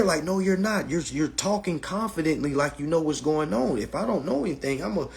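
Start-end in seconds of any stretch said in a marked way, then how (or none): none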